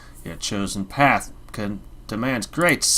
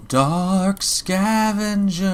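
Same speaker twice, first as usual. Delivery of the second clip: eerie drawn-out call; 8 semitones higher, 3 dB louder, much slower than usual. Acted. Mysterious entity calls player's name.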